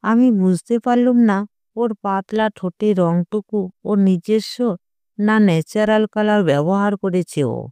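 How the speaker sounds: usual speaking voice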